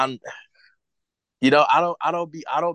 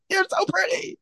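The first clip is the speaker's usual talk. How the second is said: high, sing-song "You're so pretty."; mock high voice. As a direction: coo it in a silly mock voice. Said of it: "mock answer to Toga".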